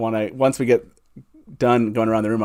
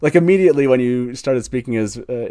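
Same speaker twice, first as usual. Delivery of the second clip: ordinary delivery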